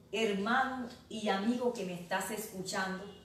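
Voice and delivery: ordinary talk, nothing marked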